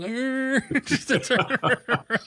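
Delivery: high, loud, laughing imitation; hammed-up effort, cracking up; laughing mimicry. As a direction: act out straining at the wheel through laughter. Mimes wrestling a huge steering wheel, laughing.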